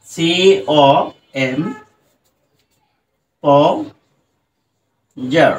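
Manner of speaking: সিওএম then পোজার drawn out extremely slowly; syllables stretched. Shown as a demonstration. Teacher stretches word parts extremely slowly for students. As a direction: stretch each part of the word. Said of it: "এরপরে দেখো".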